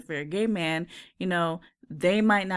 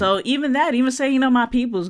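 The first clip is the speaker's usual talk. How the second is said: excited; 7 semitones higher, 8 dB louder, faster than usual. Real, not acted. Excited point about slang.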